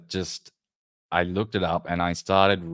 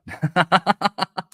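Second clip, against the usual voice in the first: high laugh; 13 semitones higher, 5 dB louder than usual. Real, not acted. Laughs at friend's remark.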